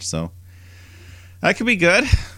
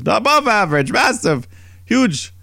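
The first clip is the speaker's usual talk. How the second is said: high, louder escalating brag; boastful wife; 13 semitones higher, 4 dB louder than usual. Retold or imitated. Host voicing Maurice bragging about her husband, high excited brag. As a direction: build each word higher, bragging harder.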